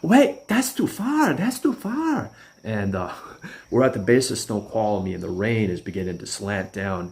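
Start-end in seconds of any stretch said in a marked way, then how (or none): 0.0-2.3 high, slow whiny protest; mocking Stevie. Stevie balking at the long drive, slow high whine. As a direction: drag it out high, panicked. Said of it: "And he's like".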